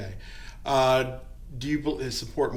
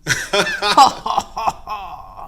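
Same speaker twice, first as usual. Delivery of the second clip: loud villainous cackle, much higher; evil genius laugh; 9 semitones higher, 9 dB louder than usual. Shown as a demonstration. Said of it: "Give me your best evil genius laugh".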